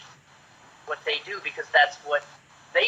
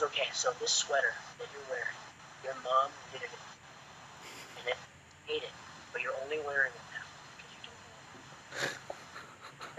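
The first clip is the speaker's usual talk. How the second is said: whisper; 2 semitones lower, 14 dB quieter than usual. Retold or imitated. Director's whispered note retold.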